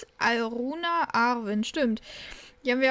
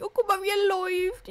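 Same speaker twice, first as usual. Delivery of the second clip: much higher cooing exclamation; delighted squeal; 8 semitones higher than usual. Real, not acted. To the viewers about the walking kitten, delighted squeal. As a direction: gush over the kitten.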